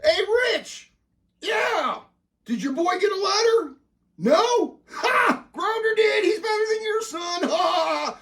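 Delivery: very high, crowing brag; squealing, triumphant taunt; gloating phone call. Acted. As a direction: rub it in with a rival dad.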